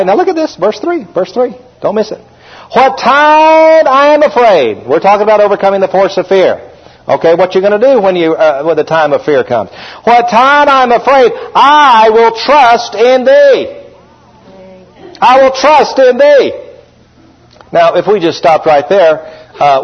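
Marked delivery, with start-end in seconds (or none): 2.7-4.9 high, very slow preached line; scripture reading. Acted. Reads scripture preacher style. 10.0-16.5 slow, raised pulpit reading; preaching scripture. Acted.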